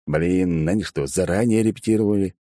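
plain reading voice

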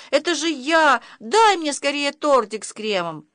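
put-on high, insistent child's plea; boy's cry. A narrator's reading. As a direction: call out urgently as a little boy. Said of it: "закричал Ваня".

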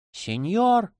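high pleading cry; girl's cry. A narrator's reading. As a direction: cry out in alarm, pitched high. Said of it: "вскричала девушка".

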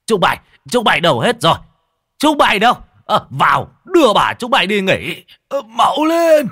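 very high, loud bellow for servants; furious shout. Acted. Husband yelling for servants, very loud. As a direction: roar at the servants to come.

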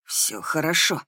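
low reassurance, slightly strained; calm her in a lightly hoarse voice; hoarse male character. A narrator's reading. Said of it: "просипел он". (сипло и спокойно)

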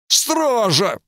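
high, frightened call for the guards; a scared king shouting for help; panicked cry. A narrator's reading. Terrified king calls for guards.

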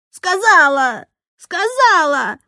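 high, loud insistence; drawn-out defiant cry; child's shouted retort. A narrator's reading. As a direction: yell back like a stubborn child. Child shouting back at teacher.

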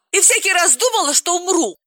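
very high, overdone wail; dramatic Galina. Acted. Galina admits she thought she'd die each time, very high. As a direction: ham it up like a drama queen.

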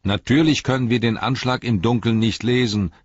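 loud annoyed reply; calling back. Acted. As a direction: shout back over the rain. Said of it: "rief Sam zurück".

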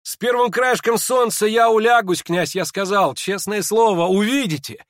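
high, loud, impassioned exclamation; excited high-pitched outburst; crying out. A narrator's reading. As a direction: cry it out, insisting on your word. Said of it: "вскричал Ипполит".

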